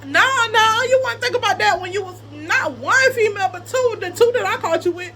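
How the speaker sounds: high, loud mock telling-off; indignant scolding. Woman retelling her rebuke to cheating ex, indignant.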